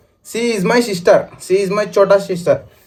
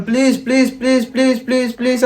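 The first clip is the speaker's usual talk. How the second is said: mock pleading; 7 semitones higher than usual. Imitates her pleading.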